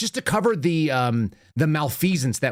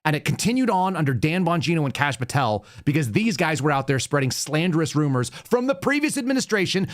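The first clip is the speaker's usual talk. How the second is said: usual speaking voice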